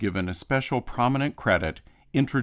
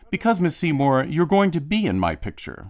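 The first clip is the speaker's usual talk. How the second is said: bold, higher and louder proclamation; confident announcement; 8 semitones higher, 4 dB louder than usual. A narrator's reading. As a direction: announce it as a done deal. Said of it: "announced with utter confidence".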